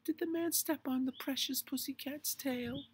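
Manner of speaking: put-on very high baby-talk to a pet; cooing. A narrator's reading. Missus Grumby comforting her stepped-on cat, cooing. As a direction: coo and fuss in a high voice. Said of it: "she cooed".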